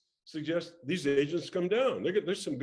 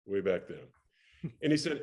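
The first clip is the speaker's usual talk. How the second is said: ordinary talk, nothing marked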